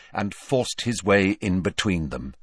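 ordinary delivery, nothing marked

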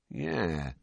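drawn-out slow agreement. A narrator's reading. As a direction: let the yeah linger. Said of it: "said Fred slowly".

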